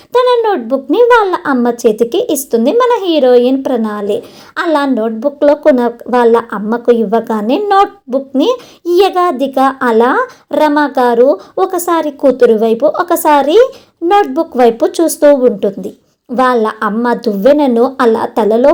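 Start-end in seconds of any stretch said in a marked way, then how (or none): none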